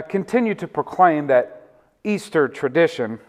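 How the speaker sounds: ordinary talk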